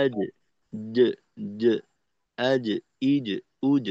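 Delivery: আজ, ইজ, উজ with an echoing, breathless জ; qalqalah on jim. Shown as a demonstration. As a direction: bounce the জ in আজ, ইজ, উজ without letting air out. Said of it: "এই ধরনের হয় আর কি". (আজ, ইজ, উজ-এ বাতাস ছাড়া জ-এর কলকলা)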